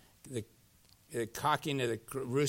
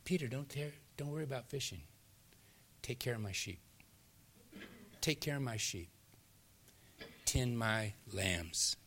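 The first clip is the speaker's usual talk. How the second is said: very soft, tender charge; hushed words with room between them; gentle Jesus; 9 dB quieter than usual. Acted. Jesus entrusts his sheep to Peter, hushed and gentle. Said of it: "what's he say each time?".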